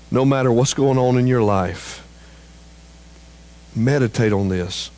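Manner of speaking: normal talking voice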